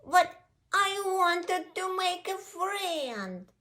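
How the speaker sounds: high, slow, drawn-out wail; high-voiced puppy lament; upset puppy. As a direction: wail it like a disappointed little puppy. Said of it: "said the puppy".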